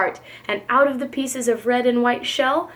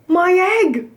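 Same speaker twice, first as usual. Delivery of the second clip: high, loud astonished cry; excited cry; 6 semitones higher, 5 dB louder than usual. A narrator's reading. Horton hearing his egg hatch, loud excited cry. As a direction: burst out at the hatching. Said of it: "said Horton".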